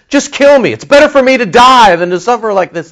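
high, loud self-pitying plea; whining Jonah. Retold or imitated. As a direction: wail it like a sulking prophet. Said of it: "And he says".

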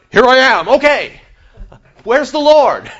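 high, loud and eager; eager newcomer. Pretend. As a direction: ask brightly for the Lord. Retelling arrival in heaven.

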